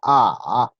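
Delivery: "A" dipping low, then rising; loud, drawn-out dipping "A"; third tone. Shown as a demonstration. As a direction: drop "A" down deep and bring it back up. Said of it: "Otra vez desde el inicio".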